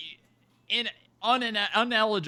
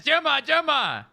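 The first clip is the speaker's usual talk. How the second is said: loud, much higher frantic shout; enraged Harold; 11 semitones higher, 6 dB louder than usual. Acted. Harold demanding to get inside, enraged shouting. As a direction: shout it like an erupting volcano. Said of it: "He says".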